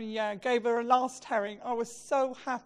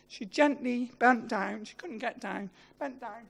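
normal talking voice

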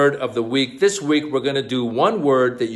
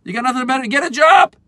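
much higher, louder scolding disbelief; scornful rant; 9 semitones higher, 4 dB louder than usual. Pretend. Mocking a friend who wants to play video games, loud high scorn. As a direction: berate a grown man gaming.

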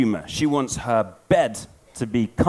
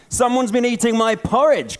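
a higher, louder mock growl from papa bear; papa bear roaring about his porridge, half-hearted; 9 semitones higher, 4 dB louder than usual. Papa bear finds his porridge eaten, mock growl. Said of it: "growled the papa bear".

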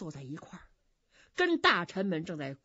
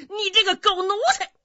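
high, loud angry shout; furious rebuke; 11 semitones higher, 10 dB louder than usual. Acted. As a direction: tear into the servant. 大将军怒斥冯子都. (怒声大喝)

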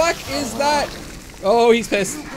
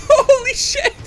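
panicked yelp; 8 dB louder, slower than usual. Real, not acted. Panics then laughs at a monster.

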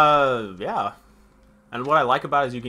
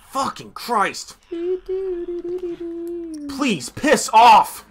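angry yell; 6 dB louder, much slower than usual. Real, not acted. Enemy attacks in game.

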